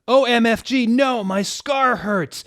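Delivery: very high, loud, slow cry; pained scream. Acted. Harry screaming that his scar hurts. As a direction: wail over the scar.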